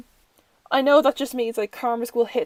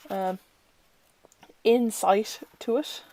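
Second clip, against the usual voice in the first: ordinary talk, nothing marked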